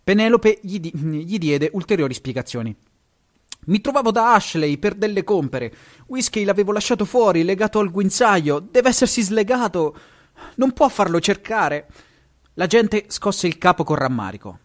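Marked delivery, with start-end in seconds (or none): 3.7-11.9 higher, urgent lady's voice; agitated lady. A narrator's reading. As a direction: plead the case to the policeman. Owner explains loss to police. (signora agitata, voce più alta)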